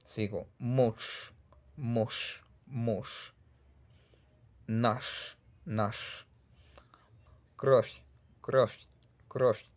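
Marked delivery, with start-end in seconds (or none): 0.6-3.3 mush with a long hushed "sh" at the end; Russian devoiced ending. Shown as a demonstration. Teacher modelling final Z pronounced sh, mush devoiced. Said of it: "mush termina con Z". 7.6-9.6 кровь as "krof" with a softened final f; Russian soft ending. Shown as a demonstration. Teacher modelling final V as F, кровь devoiced. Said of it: "se pronuncia como una F".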